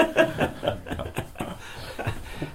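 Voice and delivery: high, wheezy laugh. Real, not acted. Storyteller tickled by his memory, laughing. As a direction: laugh helplessly at your own story.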